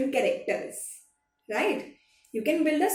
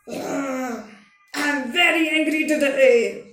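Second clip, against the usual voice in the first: harsh, loud angry person, from the throat; 6 dB louder than usual. Shown as a demonstration. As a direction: growl out an "ugh", then push the anger loudly through the throat. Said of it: "you can use your throat voice".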